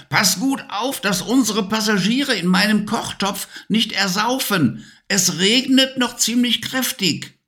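high witch voice calling over the wind; witch calling back. Acted. Witch warning Levi to guard the passengers, high calling voice. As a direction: turn and call back over the storm, witchy. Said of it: "und rief".

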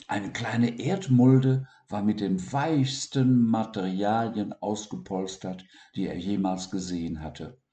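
plain reading voice

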